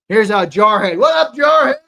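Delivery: high, booming greeting to a regular; loud shout-out. Real, not acted. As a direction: holler a big hello to a viewer.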